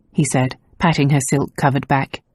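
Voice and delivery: usual speaking voice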